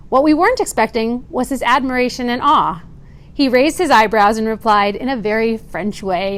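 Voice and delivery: ordinary talk, nothing marked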